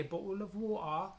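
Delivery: slow mock London accent, rising at the end; Londonish accent. Shown as a demonstration. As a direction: demonstrate a London accent, drawing the words out. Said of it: "that's like a Londonish accent".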